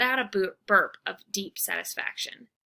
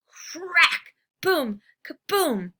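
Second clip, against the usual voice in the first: loud boom; 8 semitones higher, 6 dB louder, much slower than usual. A narrator's reading. Thunder in dream.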